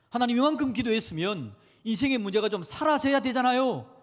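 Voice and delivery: loud, high-pitched outcry to God; frustrated shouted complaint. Acted. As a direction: yell it like a fed-up prayer. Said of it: "막 고함을 지르고 싶습니다". (하나님께 고함치듯 따지는 말투)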